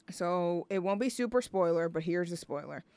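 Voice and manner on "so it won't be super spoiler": usual speaking voice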